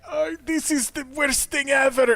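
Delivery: high, hammed-up mock lament; crocodile-tears mourner wailing to the crowd; fake wailing. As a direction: overplay a fake grief, pitched high. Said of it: "crying with crocodile tears".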